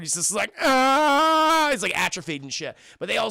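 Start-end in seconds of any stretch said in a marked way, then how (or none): 0.6-1.7 long, loud, high "ah" of discomfort; drawn-out awkward wail; awkward groan. Retold or imitated. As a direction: hold a high, awkward "ahhh". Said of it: "He's just super awkward".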